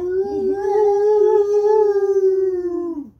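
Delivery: very high, loud squealing laugh; a piercing dog-whistle laugh; shrill high laugh. Real, not acted. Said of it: "your voice is like a dog whistle".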